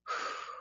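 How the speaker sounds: quiet breath out; soft closing sigh. Shown as a demonstration. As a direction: release one gentle exhale to finish. Closes the breathing practice.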